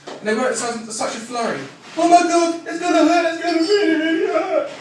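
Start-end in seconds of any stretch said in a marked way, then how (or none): 2.0-4.7 high-pitched, loud mock panic; panicked yelling. Frightened donor yelling before the needle goes in, mock panicked screaming. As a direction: act out a hammed-up scream of fear. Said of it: "yelling and screaming because they're afraid of the needles".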